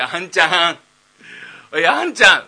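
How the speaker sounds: loud call to his son, pitch rising; father bawling for his boy. Acted. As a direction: shout across the house for him. Father calling son loudly.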